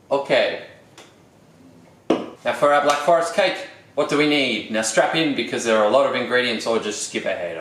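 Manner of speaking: ordinary talk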